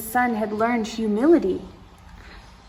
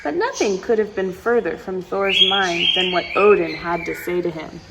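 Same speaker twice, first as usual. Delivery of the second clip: ordinary delivery, nothing marked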